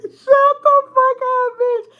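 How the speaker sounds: very high, loud squeal through laughter; drawn-out yell while cracking up; laughing shout. Retold or imitated. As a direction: squeal the retold telling-off, laughing. Repeats telling-off while cracking up.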